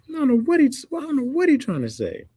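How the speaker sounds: squealed high in exaggerated confusion; very high, baffled comic voice; mock bewilderment. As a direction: protest helplessly in falsetto. Continued act of baffled listener.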